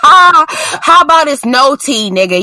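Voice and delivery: scolding. Real, not acted. Host scolding T I about the tea cure, loud. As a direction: shut the idea down hard.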